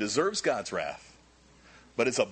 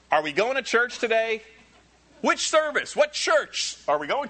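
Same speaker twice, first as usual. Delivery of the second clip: lightly voiced churchgoer asking about service, higher and louder; 7 semitones higher, 5 dB louder than usual. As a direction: play a casual member planning Sunday.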